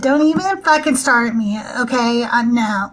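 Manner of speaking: mock-offended Valley girl accent. Shown as a demonstration. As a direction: play a disgusted Valley girl. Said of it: "I can talk like a Valley girl".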